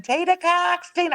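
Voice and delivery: high-pitched mock yell of a name; imitated fan scream. Excited kid waving his Wildcat flag, mock screaming. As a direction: call out like an overexcited fan, not full volume. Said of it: "just waving it and screaming".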